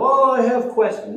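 high, prissy objection; mock objector. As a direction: play the one dodging with questions. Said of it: "don't let anyone else go".

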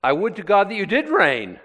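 high, loud mock longing; sarcastic wish. Preacher voicing Paul's wish that they reigned, sarcastic. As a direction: exclaim the wish as if you meant it. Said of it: "he's being sarcastic".

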